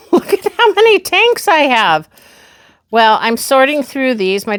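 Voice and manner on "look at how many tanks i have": high laugh, amused at herself; amused, higher disbelief; laughing surprise. Real, not acted. Laughing at finding many tanks.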